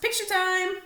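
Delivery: high, loud call; announced. A narrator's reading. Larry announcing time is up to Stanley, high and loud. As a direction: announce it like a referee. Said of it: "Larry announced".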